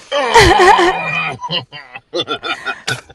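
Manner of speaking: high, loud burst of laughter. Real, not acted. Laughs at caller's language setting.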